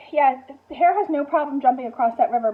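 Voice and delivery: ordinary talk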